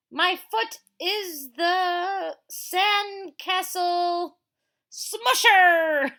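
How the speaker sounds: high, loud, very slow gloating; drawn-out mean-kid gloat, high and loud; bully taunt. Acted. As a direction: stomp the words like a mean kid. Bully in the comic.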